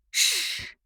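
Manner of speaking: soft unvoiced shush. A narrator's reading. Wife hushing the old aunt, soft shush. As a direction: hush her with a sharp breathy shh. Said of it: "Evelyn admonished".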